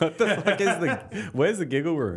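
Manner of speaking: laughing question. Real, not acted. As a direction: ask while cracking up. Amused at the story.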